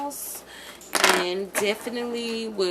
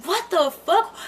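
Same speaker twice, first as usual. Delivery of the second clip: sudden high shocked swear; startled high cry; shocked outburst; 8 semitones higher, 5 dB louder than usual. Retold or imitated. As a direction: blurt it in disbelief. Retold shock again.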